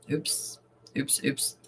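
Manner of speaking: breathy whispered oops under the breath; soft whispered oops, barely voiced. Real, not acted. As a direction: hush it out like a small slip. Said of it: "Look at me hitting all the wrong buttons".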